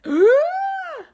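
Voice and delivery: high loud frightened scream. Acted. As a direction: shriek in fright. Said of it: "took in turns and went".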